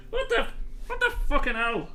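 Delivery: startled NPC. Acted. NPC wakes up dunked.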